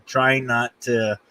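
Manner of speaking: ordinary delivery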